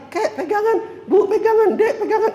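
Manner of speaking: high-pitched urgent call to each in turn; calling out to relatives. Acted. Pilgrim telling family to hold on during tawaf, high calling out. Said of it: "awas bilang".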